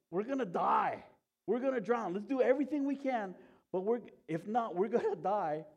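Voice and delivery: loud, high panicked yelling; mock panic. Disciples caught in a storm at sea, panicked yelling. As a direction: act out frightened men shouting over one another. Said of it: "Freaking out and going".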